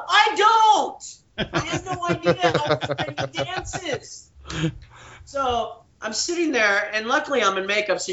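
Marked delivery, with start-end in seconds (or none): 0.1-4.3 high-pitched retold outburst; hammed-up helpless protest; mock panic. Acted outburst at director's question.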